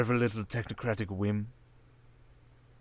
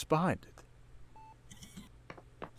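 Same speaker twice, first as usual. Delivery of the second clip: slow, soft conspiratorial line; softly savouring the secret; slow hush; 9 dB quieter, much slower than usual. Acted. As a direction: drop to a hushed reveal. Villain reveals hidden role.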